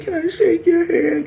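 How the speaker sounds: high simpering whine; mock crying plea. Mocking the crying enemy begging for a handshake, high whine.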